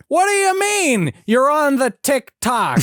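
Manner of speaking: very high, loud baffled holler; hammy nasal shouting impression; David Lynch. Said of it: "David Lynch doesn't know what the fuck TikTok is".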